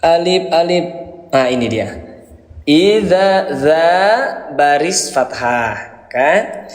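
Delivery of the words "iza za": za in Iza held long; long vowel. Shown as a demonstration. Teacher showing long alif, lengthened za. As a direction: stretch the za in Iza. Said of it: "dihadapkan dengan alif".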